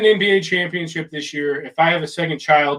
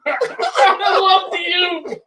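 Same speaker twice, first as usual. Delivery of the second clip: excited laughing yell; 12 semitones higher, much slower than usual. Pretend. Role play as excited player.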